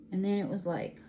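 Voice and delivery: ordinary delivery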